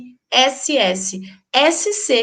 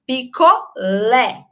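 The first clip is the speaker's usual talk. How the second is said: "Picolé" slowly, LÉ set apart and open; strong open final syllable; slower than usual. Shown as a demonstration. Teacher giving an acute accent example, strong open final syllable. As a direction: lean hard on the end of "Picolé". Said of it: "forte, o aberto".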